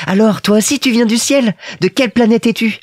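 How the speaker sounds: put-on high, questioning little-boy voice; curious Petit Prince. A narrator's reading. Little prince asks about the plane, high and curious. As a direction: ask like a small child, pitched high.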